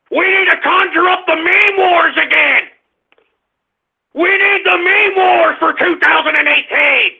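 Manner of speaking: high, forceful call to arms; impassioned rally cry; rallying shout. Real, not acted. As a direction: shout to rouse the followers. Rallying followers to meme wars.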